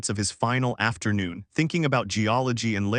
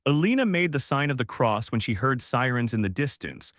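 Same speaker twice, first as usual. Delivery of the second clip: usual speaking voice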